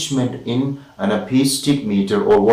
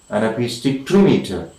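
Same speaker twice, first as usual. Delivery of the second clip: normal talking voice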